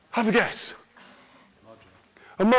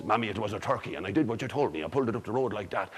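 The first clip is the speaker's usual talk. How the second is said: much lower, softer Jackie, fast at the end; low boy voice; 11 semitones lower, 10 dB quieter, faster than usual. Acted. Jackie explaining to his mammy in a low, soft voice. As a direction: mumble it low like a scolded lad. Jackie answers mother in story.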